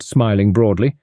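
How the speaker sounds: plain reading voice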